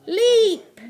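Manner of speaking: high, loud launch; leaping shout. A narrator's reading. Narrator launches the giant jump, high loud shout. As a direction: shout it like a take-off. Slog takes a gigantic jump.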